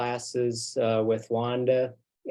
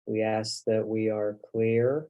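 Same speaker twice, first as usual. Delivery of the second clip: spoken prayer. Real, not acted. Minister prays for the group to be centered and grounded, hushed prayer. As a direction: pray calmly, letting each phrase rest before the next.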